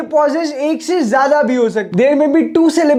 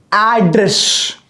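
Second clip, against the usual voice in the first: Address said slowly as "ad", "dress"; slow two-beat address for learners; split into two syllables; 3 semitones lower, much slower than usual. Shown as a demonstration. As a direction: break address after the first syllable. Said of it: "address में मैं दो जगह रुकता हूं".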